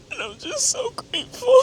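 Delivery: high, breaking voice with tears; real tears of thanks; teary gratitude. Real, not acted. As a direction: choke up with gratitude. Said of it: "Oh my God, I've been crying".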